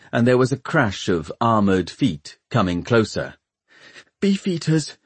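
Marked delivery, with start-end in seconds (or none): 4.2-5.0 high, small voice of dread; scared, high-pitched murmur; frightened whimper. A narrator's reading. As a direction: whimper it as the guards close in. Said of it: "she whimpered".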